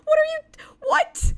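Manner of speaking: squeaky high, drawn-out disbelieving whats; mock outrage, voice climbing into a squeak; incredulous what. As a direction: stretch every what up high in shock. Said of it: "You're like".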